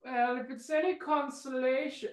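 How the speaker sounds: lightly voiced villain consolation; mock-kind villain. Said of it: "begins to speak in a terrible accent".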